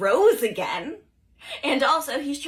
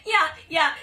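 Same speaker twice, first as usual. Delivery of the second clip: usual speaking voice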